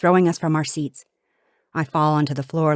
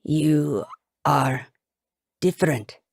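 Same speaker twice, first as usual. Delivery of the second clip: halting, word by word, slightly lower; confused captor; 3 semitones lower, slower than usual. A narrator's reading. Captor studying the princess's face, haltingly confused. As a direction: puzzle it out with long pauses. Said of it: "he says, confused".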